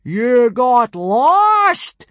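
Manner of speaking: high, loud, drawn-out disbelief; outraged bellow, every word stretched; incredulous boom. A narrator's reading. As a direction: boom it out slowly, aghast. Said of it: "boomed Gobber, not believing his ears".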